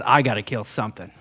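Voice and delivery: ordinary talk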